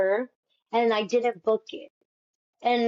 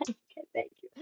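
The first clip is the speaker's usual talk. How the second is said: voiceless, far softer whisper; 12 dB quieter, much faster than usual. Retold or imitated. Thanking the panel on her way out, a tiny whisper. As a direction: mouth it back in a sheepish whisper.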